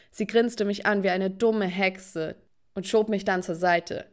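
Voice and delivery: usual speaking voice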